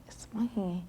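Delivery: a startled one-word whisper, rising in pitch; whispered surprise. Retold or imitated. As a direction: breathe the word in shock. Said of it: "I'm like".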